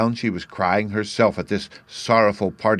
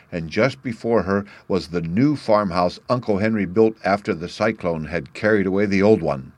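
plain reading voice

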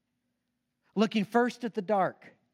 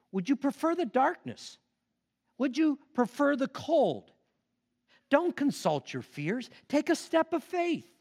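higher, pleading challenge; challenging stranger; 7 semitones higher than usual. A narrator's reading. Stranger urging cave people toward faith. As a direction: urge them to step out.

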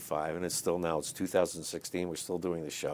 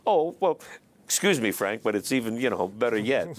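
laughing; 8 semitones higher, 4 dB louder than usual. Real, not acted. Laughing at his own gaffe.

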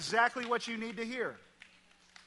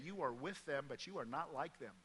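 hushed; 5 semitones lower, 11 dB quieter than usual. Shown as a demonstration. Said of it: "You need to hear that".

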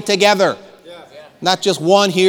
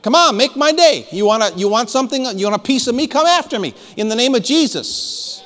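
defiant taunt; 6 semitones higher than usual. Pretend. Preacher taunting the devil's forces to come at him, defiant. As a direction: square up and dare them to try. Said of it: "We need to say".

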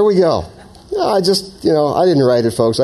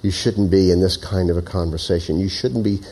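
gentle prompting; 4 semitones lower, 4 dB quieter than usual. Acted. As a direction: coax gently, hesitant and unhurried. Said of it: "kind of like a very gentle prompting or wooing".

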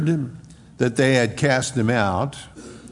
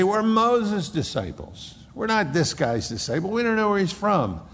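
indignant Pharisees; 8 semitones higher than usual. Retold or imitated. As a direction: puff up with wounded pride.

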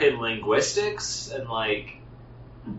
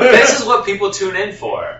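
ordinary talk

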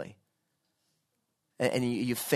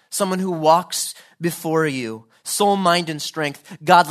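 higher, loud, rising plea to God; fervent prayer preached aloud; 5 semitones higher, 7 dB louder than usual. Real, not acted. Preacher praying to God for a stamped life, fervently. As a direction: pray it out with full force.

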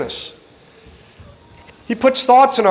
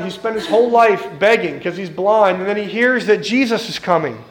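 ordinary talk

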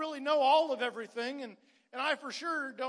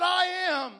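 higher and louder, a proclaimed I am; called out; 6 semitones higher, 10 dB louder than usual. Acted. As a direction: ring out I am to the whole church. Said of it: "he's calling out".

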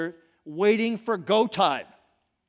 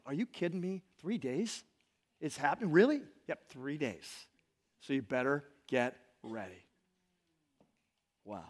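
much softer, lower, amazed murmur; hushed disbelief; 5 semitones lower, 7 dB quieter than usual. Acted. Israelites take in the news, softly and amazed. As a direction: let it drop to a hush of wonder.

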